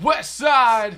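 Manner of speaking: loud high yell, West Side; shouted gang call. Pretend. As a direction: shout it like a rapper's hype call.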